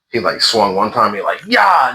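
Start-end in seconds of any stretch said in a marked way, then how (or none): 1.5-1.9 yelling boss. Retold or imitated. Boss yelling while swinging.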